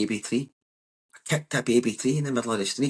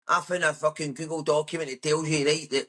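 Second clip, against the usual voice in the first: higher mocking voice of a social media know-it-all; 4 semitones higher than usual. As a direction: send up the smug internet expert.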